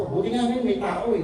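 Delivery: usual speaking voice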